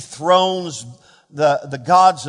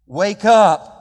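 angel waking Elijah; 5 dB louder than usual. Acted. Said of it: "If I were the angel, this might be what I would say".

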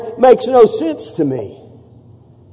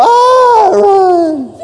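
mock terrified scream; 13 semitones higher than usual. Grandkids fleeing in terror.

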